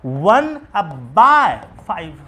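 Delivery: teacher models the reading slowly; slow model answer. Shown as a demonstration. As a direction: say three बाई वन slowly and clearly as the model answer. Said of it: "तो कैसे पढ़ेंगे?".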